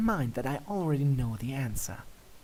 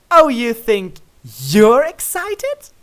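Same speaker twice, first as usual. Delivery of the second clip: much higher and louder, stretching it out slowly; gushing, high and loud pony princess; excited; 9 semitones higher, 14 dB louder, much slower than usual. Acted. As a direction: burst with giddy excitement. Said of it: "unfurling her wings in excitement".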